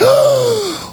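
sharp high gasp; surprised gasp. Real, not acted. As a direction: gasp in mock shock.

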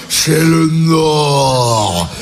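low, very slow Galabru line; low slow film quote; imitating Galabru. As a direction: drag out C'est le Nord low like Galabru. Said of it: "au lieu de dire".

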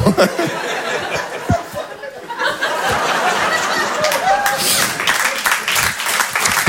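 very high, long, fading fake laugh; polite laugh. Buttering up the banker with a long, high laugh. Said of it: "le rire de politesse".

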